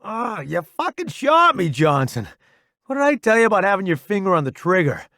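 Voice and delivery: high, loud, pained complaint; strained outburst, speeding up at the end; exasperated scolding. A narrator's reading. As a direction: chew out a buddy for shooting you. Wounded man scolds friend who shot him.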